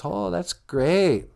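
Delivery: high, drawn-out wonder; awed delight. Acted. Dick Grayson reacts to seeing the Bat Cave, in drawn-out awe. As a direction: stretch it out in impressed amazement.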